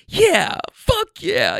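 high, slow, choked-up cheer; emotional rider voice. Retold or imitated. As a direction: voice the rider's overwhelmed yes. Mimicking rider's emotional response.

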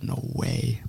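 retold disbelief, barely voiced; hushed, breathy quote of amazement; awed whisper. Retelling own amazed reaction while watching.

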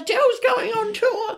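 very high-pitched, excited mum squeal; hammed-up, high-pitched mum announcement; excited mum impression. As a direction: imitate mum bursting in with big news. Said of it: "That was a great My Mum impression".

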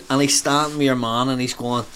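ordinary talk

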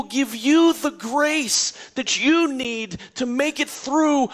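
building church preacher cry; preaching at full force. Acted.

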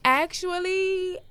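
high, drawn-out hedge; polite reluctant refusal. Retold or imitated. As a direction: stretch it out high to soften a no. Reluctantly turning down a request.

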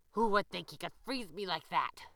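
loud, growling fox voice; a sore fox howling his complaint. A narrator's reading. The fox howls at the bunny, loud and bitter. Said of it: "howled the fox".

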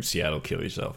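low, moping, soft; deadpan grunge mope; mopey. Retold or imitated. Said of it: "moping around going".

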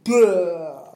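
a loud, dazed "Duh!"; dopey duh. Retold or imitated. Describing how psych meds made him feel, dazed. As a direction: sound drugged and slow-witted. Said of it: "they make me feel like".

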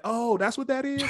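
high-pitched dawning surprise; playful "oh, I get it" surprise; mock realization. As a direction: act out finally catching on. Acting out sudden realisation.

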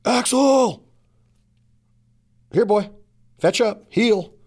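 loud, high call to a dog. Acted. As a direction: shout it across the field to your lab. Calling the dog.

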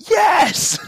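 high, loud mock-triumphant yell; exultant shout. Answering that person in an imagined moment, exultant shout. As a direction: burst out with a high, loud yes. Acting out his triumphant reply.

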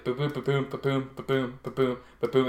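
imitated tapping. Doctor tapping round the patient's body, steady imitated thumps. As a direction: tap out a steady beat with the voice. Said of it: "he started high, and he was like".

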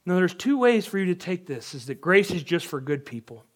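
ordinary talk, nothing marked

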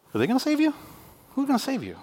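high, incredulous "gonna save you?"; God mocking the people's idols; mocking taunt. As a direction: taunt them with a sky-high question.

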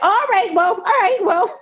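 high, laughing disbelief; high-pitched amused surprise; stunned laugh. Retold or imitated. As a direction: squeak it out, caught off guard. Stunned reaction to boss.

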